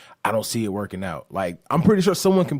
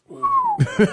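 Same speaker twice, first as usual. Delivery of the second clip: sudden high-pitched laugh; high burst of laughter; 4 dB louder than usual. Real, not acted. Laughs at own joke.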